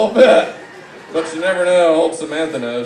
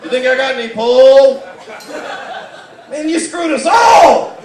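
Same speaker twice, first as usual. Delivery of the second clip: mock outraged shout; 8 semitones higher, 7 dB louder than usual. Roasting the guest in a hammed-up outraged voice. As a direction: blow up like a betrayed customer.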